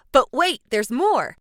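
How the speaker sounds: loud, high infomercial pitch; infomercial announcer. Pretend. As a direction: sell it like a TV ad. Infomercial catchphrase.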